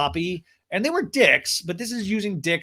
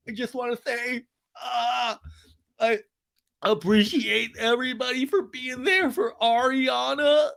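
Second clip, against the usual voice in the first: mock crying; 9 semitones higher than usual. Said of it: "And he starts to cry again".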